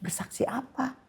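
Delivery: startled reply to the pastor's wife; surprised question. Retold or imitated. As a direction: catch her off guard with the request. Retelling her surprised reply on phone.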